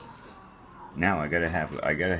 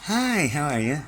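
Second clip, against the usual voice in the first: much higher, louder mock-cheery hello; fake sweet greeting; 10 semitones higher, 5 dB louder, slower than usual. Hiding evil thoughts behind a mock-polite hello, high. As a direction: cover evil thoughts with a bright put-on greeting.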